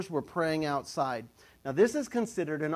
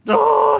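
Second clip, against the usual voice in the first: loud, high-pitched mock yell; sudden high holler acting out the angel's appearance; startling shout; 15 dB louder than usual. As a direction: burst out with a sudden "Oh!".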